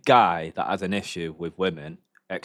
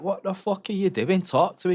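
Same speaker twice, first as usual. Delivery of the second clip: high, incredulous "what the fuck are you doing"; scolding a boy who hits a girl, high and outraged; exasperated outburst; 10 semitones higher than usual. Retold or imitated. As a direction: yell at the guy in disbelief. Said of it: "And it's like".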